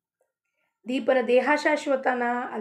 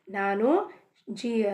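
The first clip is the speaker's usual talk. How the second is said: normal talking voice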